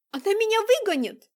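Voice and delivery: high, loud fearful outcry; panicked cry. Acted. Nastya fears being thrown out.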